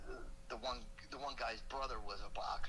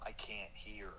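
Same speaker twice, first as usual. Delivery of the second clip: faint whisper; 5 semitones lower, 5 dB quieter, slower than usual. Retold or imitated. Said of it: "Andy looks at me and goes".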